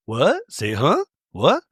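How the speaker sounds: imitated confusion, short rising questions; mock-puzzled little double take; baffled reaction. Acting out Angie's baffled reaction.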